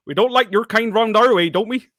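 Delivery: high, loud mock Gloucestershire; Gloucestershire accent. Shown as a demonstration. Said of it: "who can do the best Gloucestershire accent".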